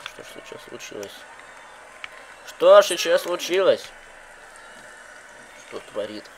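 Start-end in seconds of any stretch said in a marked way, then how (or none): 0.1-1.2 whispering. Acted. Repeated question, hushed then loud. 2.5-3.8 loud. Acted. Repeated question, hushed then loud.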